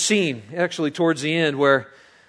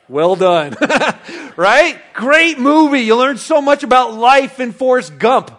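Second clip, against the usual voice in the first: ordinary delivery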